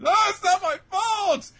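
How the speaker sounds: very high, loud whiny squawk; indignant protest. Retold or imitated. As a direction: shriek your innocence in mock indignation. Acted protest after being blamed.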